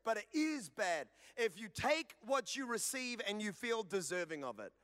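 ordinary talk

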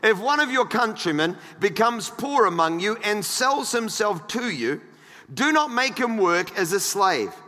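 emphatic, projected pulpit reading; measured Bible reading with weighted key words; scripture reading. Acted. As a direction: read the verses out to a congregation. Reads scripture aloud to congregation.

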